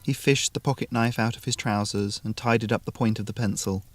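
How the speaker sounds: plain reading voice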